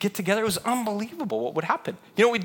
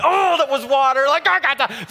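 raised, louder exclamation of wonder; acted amazed excitement; 5 semitones higher, 5 dB louder than usual. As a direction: burst out as an astonished guest. Acted guest marvels at the miracle.

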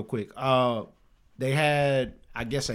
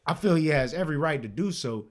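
ordinary talk

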